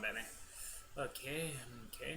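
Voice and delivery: ordinary delivery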